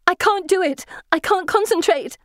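high, frantic protest; panicked. A narrator's reading. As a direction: blurt it out in desperation. Failing magic while being chased.

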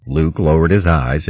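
ordinary delivery, nothing marked

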